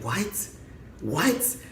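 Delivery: small, high, soft questioning voice; stunned disbelief. Retold or imitated. Reacting to the caller's phone rules, softly stunned. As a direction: squeak it out softly, taken aback. Stunned reply to scammer's instructions.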